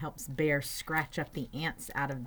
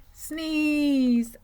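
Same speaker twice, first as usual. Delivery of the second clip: loud, drawn-out rise before a sneeze; slow, swelling storybook suspense; building to a sneeze; 10 semitones higher, 7 dB louder than usual. Acted. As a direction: stretch the word as the sneeze builds.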